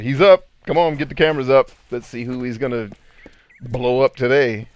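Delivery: rally the crew, lightly acted; excited news crew. Acts out news crew scrambling.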